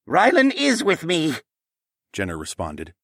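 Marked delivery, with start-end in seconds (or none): 0.1-1.4 high, slow, loud hesitant reply; dodging the real question; high, slow guarded answer; evasive answer. Acted. Trapped man answers evasively.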